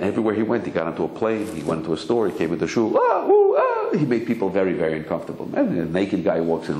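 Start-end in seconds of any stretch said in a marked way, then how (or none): 3.0-4.0 high mock gasps of shock; hammed-up high shocked cries. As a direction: gasp like a scandalized crowd. People shocked by naked man.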